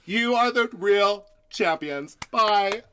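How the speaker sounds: high, loud bubbly farewell; gushing sign-off. Pretend. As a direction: wave goodbye like a TV host. Hoda signs off gushing.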